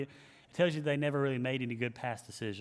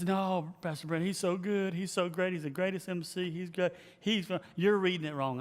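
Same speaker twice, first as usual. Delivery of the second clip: higher-pitched insistent pleading; protesting staffer; 4 semitones higher than usual. Retold or imitated. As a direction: imitate gushing staff objecting. Staff protesting in retold argument.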